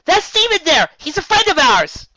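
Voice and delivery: half yell. A narrator's reading. Twilight to Megan, a loud high yell. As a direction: stop her finger with your voice.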